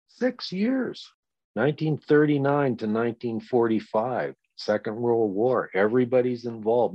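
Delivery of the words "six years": can you believe it, six years; incredulous. Real, not acted. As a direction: echo it much higher in disbelief. Incredulous echo of his point.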